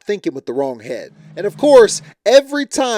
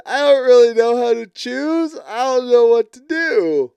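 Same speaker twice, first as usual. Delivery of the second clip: high, whiny voice, hammed-up tears; mock crying; 8 semitones higher, 5 dB louder than usual. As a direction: imitate her helpless sobbing. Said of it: "she cries and said".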